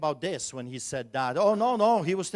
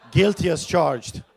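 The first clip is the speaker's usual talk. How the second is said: laughing confession; 3 semitones higher, 11 dB louder than usual. Retold or imitated. Laughing reply to inmate.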